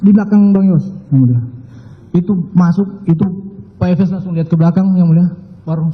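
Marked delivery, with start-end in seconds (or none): none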